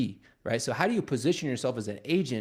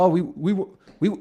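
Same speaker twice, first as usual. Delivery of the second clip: flustered; 7 semitones higher, 6 dB louder than usual. Retold or imitated. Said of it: "a little flustered".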